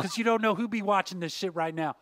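ordinary talk, nothing marked

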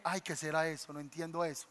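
lightly mocking a baffled believer; mock confused churchgoer. Imita a los confundidos. (burla suave de un creyente confundido)

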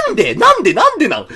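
high, loud exasperated cry; exasperated shout. Acted. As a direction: demand an answer in mock despair. Mock despair rant.

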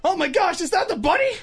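high, shocked disbelief, laughing at the end; shocked gasp. Retold or imitated. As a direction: gasp it out in horror, voice climbing. Wrestlers shocked at the bunny.

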